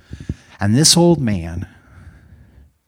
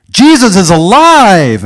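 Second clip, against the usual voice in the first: high, very loud drawn-out shout; big, slow proclamation yell; insistent yell; 12 semitones higher, 15 dB louder, slower than usual. Retold or imitated. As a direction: shout it to rouse a sluggish crowd. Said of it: "old man was sort of discouraged".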